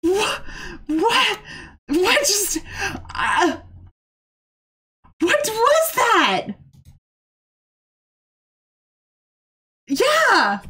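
high, slow stunned questions; adrenaline rush. Real, not acted. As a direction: still shaken, asking in disbelief. Recovering from scare.

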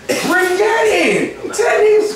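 very high, slow, laughing call for the food; excited high call. Real, not acted. Hosts cheer as the pizza arrives, high laughing call.

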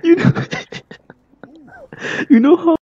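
high giddy panicked laugh; scared giddy laughing. Real, not acted. Panicked laughing mid fight.

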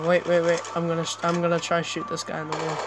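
usual speaking voice

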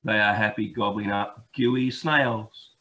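ordinary delivery, nothing marked

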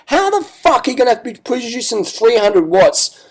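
high-pitched, incredulous question; exasperated disbelief. Real, not acted. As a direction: ask it high, baffled by the logic. Rants at absurd gearing logic.

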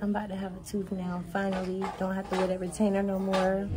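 whispered nerves waiting for the dentist; nervous hush. Real, not acted. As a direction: speak hushed and nervous in the chair. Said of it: "I'm nervous".

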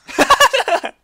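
shrill cackle; high burst of laughter. Real, not acted. Laughing as a teammate gets knocked off, loud laugh.

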